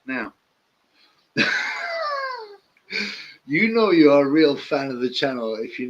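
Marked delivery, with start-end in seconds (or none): 1.4-2.4 short high-pitched giggle. Real, not acted. Laughing at a viewer's comment, high and giggly.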